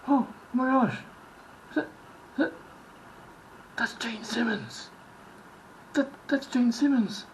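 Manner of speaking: starstruck fan. Retold or imitated. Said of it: "suddenly you're like".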